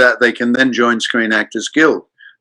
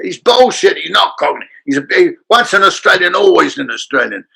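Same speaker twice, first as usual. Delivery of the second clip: high scoffing Cockney heckle; Bob Hoskins; 7 semitones higher than usual. Retold or imitated. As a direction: cut in as a scornful Cockney. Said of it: "He said".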